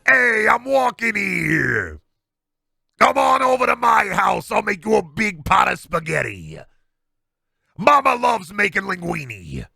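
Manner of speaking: put-on high, loud, slow New York accent monster bellow. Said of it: "New York monster accent".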